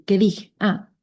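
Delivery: ordinary talk, nothing marked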